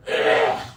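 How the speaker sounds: sharp high cough; hard cough. Real, not acted. Coughing fit mid-story.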